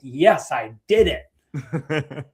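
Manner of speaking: drawn-out, slow triumphant cry; lightly hammed-up victory through a laugh; mock triumph. Voicing Lisa after her pillow scream, mock triumph.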